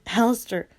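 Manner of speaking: calling out his name, high and loud; anguished shout of a name; desperate cry. Acted. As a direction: cry out after him as he vanishes. Calling after vanishing lover.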